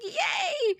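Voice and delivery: very high, loud squealed cheer; gleeful cheer. Retold or imitated. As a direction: let out a delighted "yay". Retold cheer at lifted curse.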